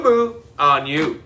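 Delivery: usual speaking voice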